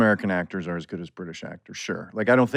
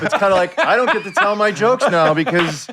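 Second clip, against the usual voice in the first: words broken by laughter, raised pitch and louder; giggling mock grumble; laughing through it; 10 semitones higher, 7 dB louder than usual. Real, not acted. Laughing while acting out actor.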